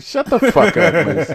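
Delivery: high, laughing protest. Real, not acted. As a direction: laugh while telling them off. Laughing at friend's joke.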